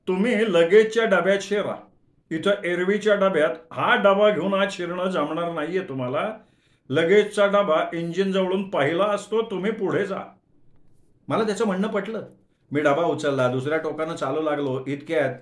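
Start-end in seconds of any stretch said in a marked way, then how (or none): 0.1-10.2 higher and louder, insistent advice; helpful gentleman advising. Acted. Gentleman tells the narrator which coach to board, higher and louder. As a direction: urge him firmly toward the front coach. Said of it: "एक सद्गृहस्थ सांगायला लागले".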